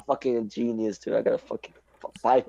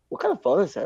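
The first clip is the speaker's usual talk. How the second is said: higher imitated question; curious classmate; 7 semitones higher, faster than usual. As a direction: mimic an impressed classmate asking.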